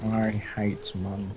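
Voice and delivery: low, slow, quiet Snape drawl; a hushed Snape voice, in no hurry; subtle Snape. Retold or imitated. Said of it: "Sounded like a subtle, uh, Snape".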